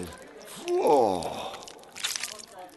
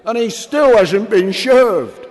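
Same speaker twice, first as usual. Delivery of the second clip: much higher and louder, a jeering yell; drunken shout; 10 semitones higher, 11 dB louder than usual. Acted. Drunk on the floor mocks the waiting Cromwell, loud jeering shout. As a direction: yell it across the tavern floor. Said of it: "shouted a drunk from the floor".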